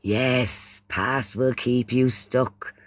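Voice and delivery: usual speaking voice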